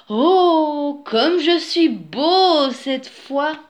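high, loud, drawn-out admiration; admiring exclamation. A narrator's reading. Wolf admires his peacock feathers, delighted exclamation. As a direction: exclaim it slowly, delighted with yourself.

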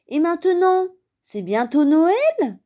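put-on high, eager child's question; impatient little bear. A narrator's reading. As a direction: pester like an impatient kid. Small bear insists, impatient. (impatient, voix aiguë d'enfant)

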